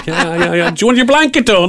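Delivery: high, chirpy pretend dog talking; dog's imagined voice. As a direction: voice the dog as a cheery little housemate.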